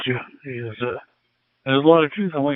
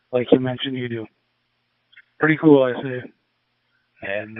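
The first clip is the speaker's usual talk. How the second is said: ordinary talk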